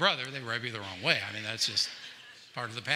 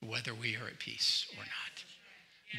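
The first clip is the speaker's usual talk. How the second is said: whispering; 5 semitones lower, 8 dB quieter than usual. Real, not acted. Soft point in sermon.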